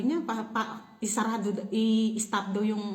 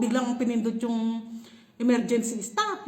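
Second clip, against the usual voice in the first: normal talking voice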